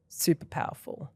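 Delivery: soft breathy whisper of the same words; whispered echo. Shown as a demonstration. Host repeating praise for the interview, whispered. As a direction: let it fall to a whisper, to yourself.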